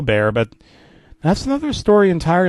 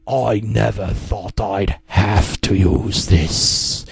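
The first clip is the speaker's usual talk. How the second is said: low, slow, menacing hiss; 7 semitones lower, much slower than usual. Acted. Daddy Pig hissing as he holds the shotgun. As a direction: breathe it out low and slow, threatening. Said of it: "Daddy Pig hissed".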